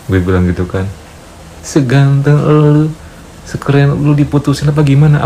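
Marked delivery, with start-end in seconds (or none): 1.6-5.2 mock disbelief. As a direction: draw it out as if it's impossible, higher. Flattering disbelief at the breakup. (heran berlebihan, pelan dan nada tinggi)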